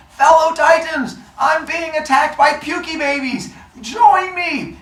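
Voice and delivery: high mock shout for help; calling out. Kronos calls the Titans to fight, shouting.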